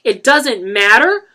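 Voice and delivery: furious disbelief; outraged mother. A narrator's reading. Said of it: "Claudette said".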